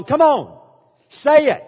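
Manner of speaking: loud, high urging of the crowd; imitating a revival preacher urging the crowd. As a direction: whip them up, loud and high. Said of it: "but he moves on".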